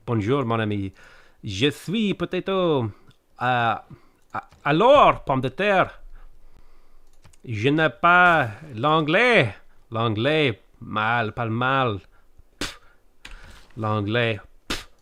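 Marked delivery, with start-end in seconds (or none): 0.1-12.0 slow, drawn-out French accent; put-on French. Shown as a demonstration. Streamer trying French for chat, hammed-up French accent. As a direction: ham up the French, stretching stressed syllables. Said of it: "can I do French?".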